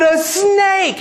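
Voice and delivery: high-pitched comic alarm; mock panic. Manager telling the customer what he saw, mock panic. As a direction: blurt it very high, imitating a frightened man. Terrified manager reports the snake.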